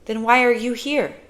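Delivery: lightly voiced child pressing for an answer; young girl. A narrator's reading. Girl asks the boy why he came, puzzled.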